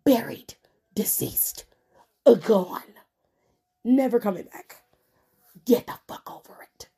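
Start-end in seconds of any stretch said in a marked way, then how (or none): 0.9-1.7 a sudden breathy whisper, low and hushed; unvoiced whisper. Real, not acted. Podcaster to fans, the brand is dead, whispered. 5.6-6.9 hissed aside. Real, not acted. Ranting at complaining fans.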